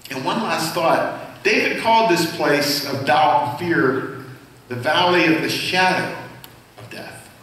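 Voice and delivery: usual speaking voice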